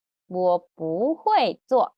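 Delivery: "我不会做" slow, firm tones; slow model answer. Shown as a demonstration.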